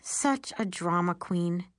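plain reading voice

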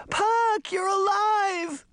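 high, drawn-out cry of relief; joyful shout. A narrator's reading. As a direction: shout it out in pure delight. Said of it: "Daphne shouted".

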